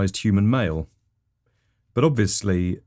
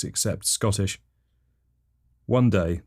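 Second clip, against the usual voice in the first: normal talking voice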